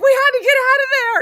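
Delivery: loud, high, quick outburst; retold panicked protest. Kid excusing her escape to grandma's defender, loud panicked protest. Retells fleeing child's defiant answer.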